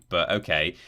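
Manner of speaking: usual speaking voice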